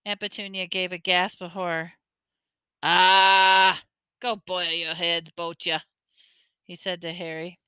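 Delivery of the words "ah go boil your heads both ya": Hagrid voice. A narrator's reading. Hagrid dismissing Dursleys.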